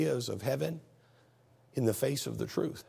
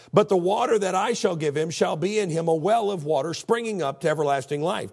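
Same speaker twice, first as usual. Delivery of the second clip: loud, raised scripture declamation; preacher's scripture reading; 4 semitones higher, 6 dB louder than usual. Acted. Preacher reading Jesus' words to congregation, forceful proclamation. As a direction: proclaim the verse at full force.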